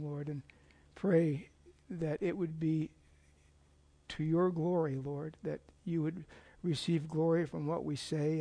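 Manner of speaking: soft, quiet earnest prayer to the Lord. Acted. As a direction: pray it softly, head bowed. Opening prayer before the sermon.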